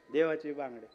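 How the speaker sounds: ordinary delivery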